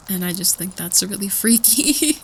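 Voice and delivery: ordinary talk, nothing marked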